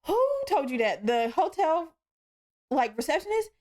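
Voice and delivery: half-laughing disbelief at the guard; retold amused outrage; incredulous. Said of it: "I bust out laughing".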